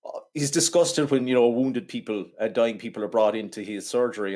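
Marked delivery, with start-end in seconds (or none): none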